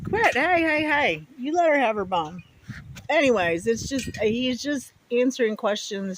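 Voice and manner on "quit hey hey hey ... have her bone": sharp raised hey hey hey; scolding the dog. Real, not acted. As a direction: call the dog off firmly. Scolding the dog over a bone.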